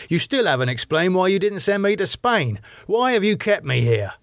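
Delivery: high, accusing character voice; demanding David. A narrator's reading. David challenging Elizabeth for keeping him here, demanding.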